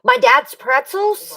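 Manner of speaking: sudden alarm over the gift pretzels; shocked gasp. Real, not acted. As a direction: caught off guard and alarmed. Shocked at son eating gift.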